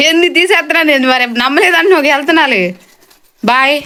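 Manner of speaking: exasperated pleading. Real, not acted. Son protesting mother won't believe.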